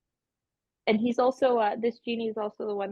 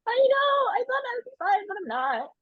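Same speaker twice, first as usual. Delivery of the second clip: tearful high wail. Acted. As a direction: wail it out, close to sobbing. Nixie upset after being left behind.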